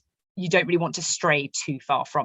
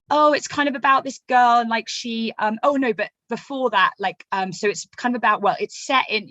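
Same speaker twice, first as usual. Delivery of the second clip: higher, flustered mock rambling pitch; getting lost; 6 semitones higher, 4 dB louder than usual. Writer badly pitches a book to people, mock rambling and getting lost. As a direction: stumble and restart, losing the thread. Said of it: "and get lost in that".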